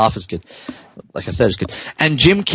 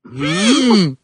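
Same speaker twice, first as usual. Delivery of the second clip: soft whimper; 3 semitones higher than usual. Acted. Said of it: "he whimpers softly".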